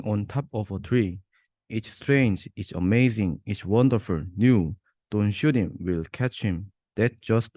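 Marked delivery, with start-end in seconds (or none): none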